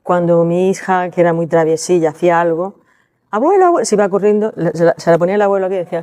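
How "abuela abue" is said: put-on high child's cry of abuela; a little girl calling for her grandmother. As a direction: call out like a small girl running for help.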